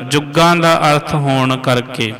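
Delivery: ordinary delivery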